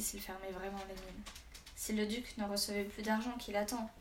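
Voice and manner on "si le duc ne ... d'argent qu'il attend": plain reading voice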